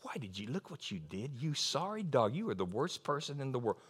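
low, contemptuous condemning voice; accusing voice. Retold or imitated. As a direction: sneer at him quietly. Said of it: "he says".

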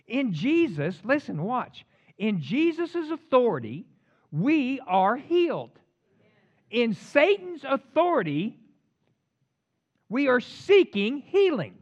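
slow, high, loud preacher delivery; emphatic preaching. Real, not acted.

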